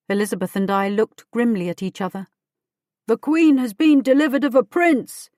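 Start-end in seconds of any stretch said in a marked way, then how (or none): 3.1-5.3 raised, high proclaiming cry; excited shout from the roadside; cried out. A narrator's reading. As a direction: call the news out along the road. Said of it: "someone along the road cried".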